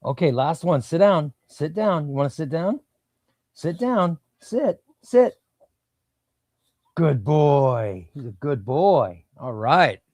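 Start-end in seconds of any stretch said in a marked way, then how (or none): 0.8-4.2 firm sit command, pitched up; dog command. Real, not acted. 4.4-4.8 sharp high sit command; firm command. Real, not acted. 5.0-5.3 high sharp sit, louder; loud command. Real, not acted. 6.9-9.2 praising the dog. Real, not acted. Praising dog after it sits.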